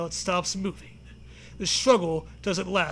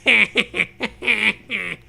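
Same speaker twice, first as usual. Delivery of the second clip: laughing; 2 semitones higher, 7 dB louder than usual. Real, not acted. Laughing at sand hands.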